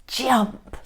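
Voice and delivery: usual speaking voice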